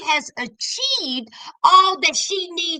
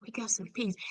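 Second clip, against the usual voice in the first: much lower and far softer, a relieved hush; relieved sigh; 9 semitones lower, 16 dB quieter than usual. Retold or imitated. Anthony alone in the car after leaving, hushed relief. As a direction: breathe it out like sweet relief. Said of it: "he was like".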